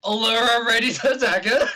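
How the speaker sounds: high, loud eager call; eager shout. Real, not acted. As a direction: shout it out, raring to go.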